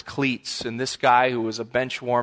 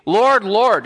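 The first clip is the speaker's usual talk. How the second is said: higher, louder calling out to the lord; pleading call; 6 semitones higher, 6 dB louder than usual. Retold or imitated. Shut-out bridesmaids calling loudly at the door. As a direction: call out to be let in. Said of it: "they say".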